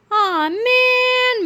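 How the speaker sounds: put-on high, whiny childish groan. A narrator's reading. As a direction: drag it out like disappointed kids.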